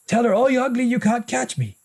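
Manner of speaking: very high, hurried coaching; feed him the words fast; urgent prompting. A narrator's reading. Big brother feeds the taunt, high and hurried. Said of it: "Kieran said".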